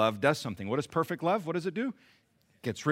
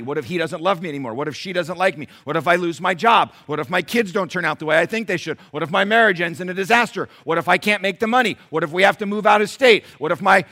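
anxious what-ifs piling up, louder and higher; mounting worry; 4 semitones higher, 9 dB louder than usual. Acted. Preacher voices people riddled with worry, mounting anxiety. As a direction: build a mock spiral of worry. Acting out spiralling anxious worries.

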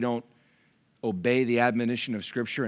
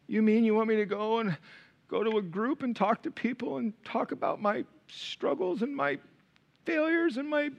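whiny reluctance; 8 semitones higher, 6 dB quieter than usual. Retold or imitated. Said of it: "how most of us feel".

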